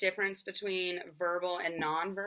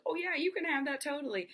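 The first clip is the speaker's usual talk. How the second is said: bright, much higher eager yes; excited parent; 8 semitones higher, faster than usual. Retold or imitated. Parent giving the pointing child what it wants, excitedly higher. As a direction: gush with delight as you give in. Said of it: "gets all excited".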